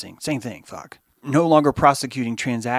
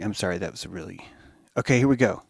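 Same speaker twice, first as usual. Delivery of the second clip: ordinary delivery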